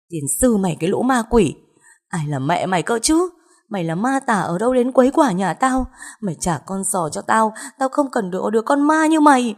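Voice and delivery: angry cursing that climbs to a high, loud cry; furious screaming. Acted. Mother rejecting the ghost and demanding her child, furious shouting. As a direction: lash out at the ghost, rising to a scream. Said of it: "rồi gào ầm lên".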